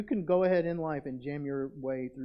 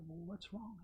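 hushed gentle question; 4 semitones higher, 14 dB quieter than usual. Retold or imitated. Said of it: "I said".

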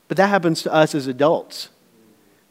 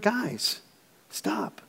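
soft, breathy whisper of a stop; a tired dad whispering to fighting sons; hushed warning; 5 semitones higher, 11 dB quieter than usual. Retold or imitated. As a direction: hush the boys without raising your voice. Dad hushing sons fighting at night.

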